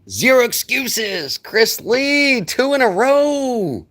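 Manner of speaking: high, loud and drawn out slowly; excited greeting. Real, not acted. Streamer greeting a returning viewer in chat, excited and drawn out. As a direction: sing out the viewer's name with real delight.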